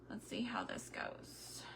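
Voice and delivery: hushed whisper, holding her breath. Real, not acted. Pouring the resin, she whispers to herself in suspense. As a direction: breathe the words, no voice.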